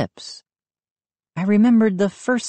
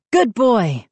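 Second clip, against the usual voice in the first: high, glad praise to the dog; boy relieved his dog came back; relieved cry; 7 semitones higher, 4 dB louder than usual. A narrator's reading. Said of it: "I cried in relief".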